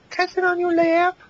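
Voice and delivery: put-on very high squeaky girly voice; mock bimbo stripper. Friend mocking pushy strippers asking for laps, very high.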